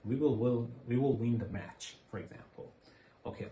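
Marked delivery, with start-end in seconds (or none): 0.1-1.8 will punched loud with a falling tune; stress on will. Shown as a demonstration. Teacher drilling a sentence, will punched loud. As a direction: lean hard on will each time. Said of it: "stress falls on".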